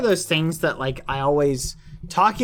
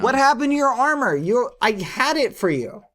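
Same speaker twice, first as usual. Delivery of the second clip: high, fast, loud scolding; heated, indignant outburst; exasperated yelling; 8 semitones higher, 4 dB louder than usual. Pretend. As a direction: demand answers from a careless friend. Scolding Quackity over lost armor.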